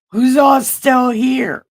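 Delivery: loud call to the room, asking who's still there. Real, not acted. Preacher calls out to the congregation, checking who's still there, loud call.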